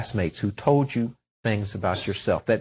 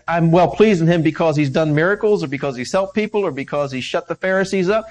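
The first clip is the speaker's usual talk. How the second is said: loud, fervent sermon delivery; preaching at full force; 3 semitones higher, 4 dB louder than usual. Acted. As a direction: drive the point home from the pulpit.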